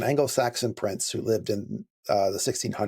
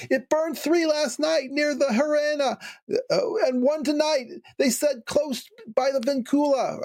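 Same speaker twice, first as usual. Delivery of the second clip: much higher voice for the scared woman; frightened hoteler; 14 semitones higher than usual. Acted. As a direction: pitch it up high as the panicked hoteler. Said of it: "so she's still talking".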